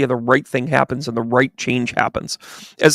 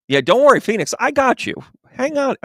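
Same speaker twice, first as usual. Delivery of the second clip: sing-song reassurance; 11 semitones higher than usual. Real, not acted. Reassuring a viewer.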